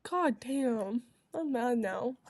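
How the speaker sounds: ordinary delivery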